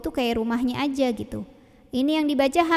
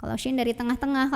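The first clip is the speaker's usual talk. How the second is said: ordinary talk, nothing marked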